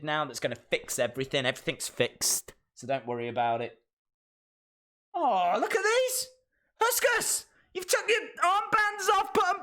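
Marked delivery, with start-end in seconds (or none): 5.1-9.6 very high squealed fussing; squeaky mock dismay; high-pitched fussing. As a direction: fuss at someone in a squeaky, put-on voice. Mock fussing over Huskus.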